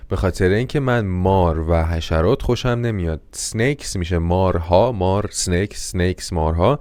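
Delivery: ordinary talk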